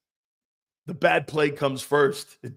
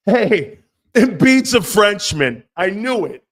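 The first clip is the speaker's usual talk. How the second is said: loud, slow, higher rant; 5 semitones higher, 9 dB louder, slower than usual. Real, not acted. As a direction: bellow it slowly. Host rants about Frenchmen.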